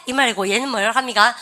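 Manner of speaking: ordinary delivery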